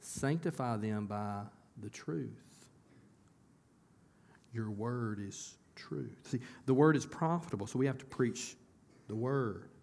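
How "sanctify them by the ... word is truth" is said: a softer, slow prayerful hush; Jesus praying. Retold or imitated. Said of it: "he's praying".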